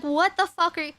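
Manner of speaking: upset disbelief. Retold or imitated. Talking back to her lying partner, exasperated. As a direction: fling it back in angry disbelief. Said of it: "I was very upset".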